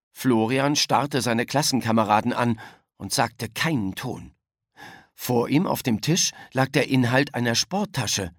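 plain reading voice

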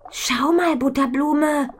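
put-on high, loud girl pointing something out; excited girl calling out. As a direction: call your friend over, eager. Mädchen ruft Freundin herbei. (aufgeregtes Mädchen, hohe Stimme)

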